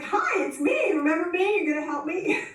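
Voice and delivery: high, hopeful mock plea; eager pleading. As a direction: sound like a kid begging a favor. Calling rancher, eager for help.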